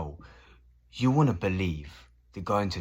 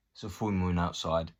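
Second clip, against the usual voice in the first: ordinary delivery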